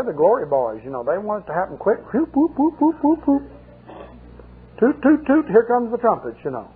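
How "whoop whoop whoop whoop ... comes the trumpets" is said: much higher, hammed-up whooping and tooting; mock fanfare. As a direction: lampoon the glory boys' hype. Mocking lazy glory-seekers.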